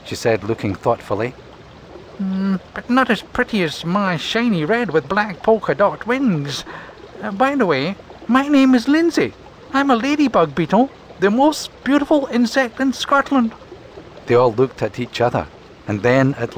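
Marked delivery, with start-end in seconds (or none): 2.2-13.5 high, boastful ladybug voice; Lindsay the ladybug. Acted. Ladybug bragging to the others about her wings, high and vain. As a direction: show off her own beauty.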